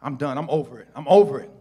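normal talking voice

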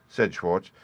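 plain reading voice

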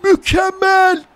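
long drawn-out goal scream, very high; screaming football commentator. Real, not acted. Goal call continues. (çığlık atan futbol spikeri)

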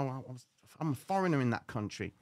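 ordinary talk

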